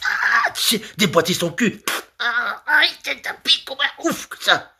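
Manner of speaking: very high, squealing hammed-up cries; mock frenzy. Comedian acting out the lover's crude intent, squealing mock frenzy. Acts out the crude sex scene.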